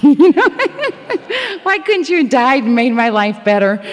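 laughing through a dark joke; laughing confession. Real, not acted. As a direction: chuckle it out, half-ashamed. Laughing at own dark wish.

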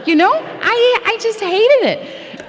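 high, fired-up and half-laughing; confessing a long grudge to the group, high and worked up; worked-up confession. Real, not acted. Fired-up rant about old resentment.

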